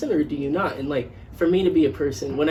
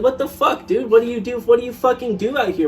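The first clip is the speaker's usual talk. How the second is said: much higher, louder outraged yell; mom yelling; 8 semitones higher, 4 dB louder than usual. Retold or imitated. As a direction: imitate a furious mom storming in. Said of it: "she'd be like".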